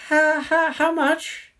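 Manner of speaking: very high stuttering squeak; nervous stammer. A narrator's reading. Cornered man asking the price, frightened high stammer. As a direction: trip over the first word, voice pitched right up. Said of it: "I stammer".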